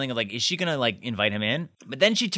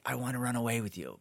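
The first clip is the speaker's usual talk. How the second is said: much softer, understated confession; soft plea; 2 semitones lower, 9 dB quieter, faster than usual. Pretend. Suitor quietly asking Rory to run away, soft. Gentler version contrasted with yelled one.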